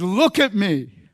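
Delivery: a touch of angry insistence, drawn out; mad retort. Retold or imitated. Mad kid answering his dad back, angry. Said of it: "I was mad".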